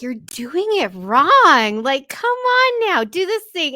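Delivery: loud, drawn-out, then leaping high; mock-exasperated, pitch swooping up; exasperated scolding. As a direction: imitate a fed-up expert chiding amateurs. Acts out exasperated character scolding.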